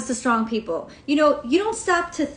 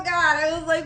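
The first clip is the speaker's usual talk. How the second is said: ordinary talk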